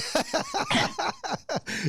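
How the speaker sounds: high squealing cackle; squealing laugh. Real, not acted. Laughing at embarrassing story.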